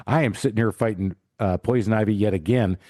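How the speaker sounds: normal talking voice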